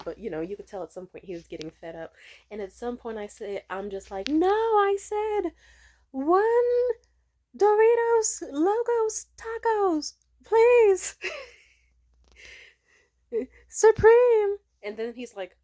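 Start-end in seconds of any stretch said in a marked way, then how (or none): 4.3-11.2 high, loud and slow, each word spelled out; re-order like the cashier is deaf; exasperated repeat order. Retold or imitated. Drunk customer repeating her order. 13.7-14.6 high and loud, one stressed word; emphatic add-on. Retold or imitated. Drunk customer adding to her order.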